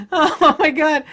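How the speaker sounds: real laugh breaking into high words; exasperated laughing exclamation. Real, not acted. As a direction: laugh it out in disbelief. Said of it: "I'm not laughing because it's funny".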